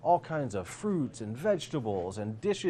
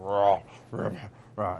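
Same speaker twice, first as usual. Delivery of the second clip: gobbling growl; 2 semitones lower, 4 dB louder than usual. Acted. Mayor devouring his meal.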